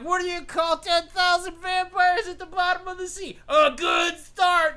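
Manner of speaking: high, loud shouted put-on voice; hammed-up shouting villain; mock shouted heckle. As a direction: bellow the joke like a heel on stage. Author's heel jokes, shouted.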